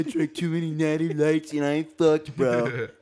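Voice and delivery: high, loud put-on voice; mocking Joey Pompiani. Co-host mocking his friend's podcast sign-off, high loud impression. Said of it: "I'm Joey Pompiani".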